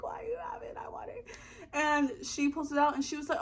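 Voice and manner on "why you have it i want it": hushed greedy whisper. Retold or imitated. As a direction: breathe it out, no voice, wanting it. Covetous aside whispered.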